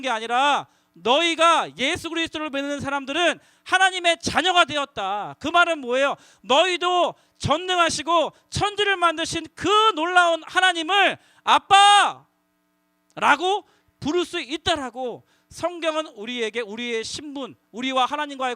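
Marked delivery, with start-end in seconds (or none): none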